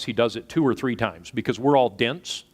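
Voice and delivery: ordinary delivery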